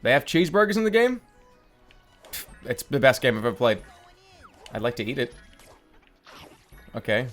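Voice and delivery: ordinary talk, nothing marked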